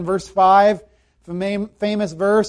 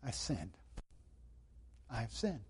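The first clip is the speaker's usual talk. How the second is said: barely voiced, much softer and lower; hushed confession; 9 semitones lower, 25 dB quieter than usual. David confessing to Nathan, hushed and broken. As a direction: let David's confession fall to almost nothing.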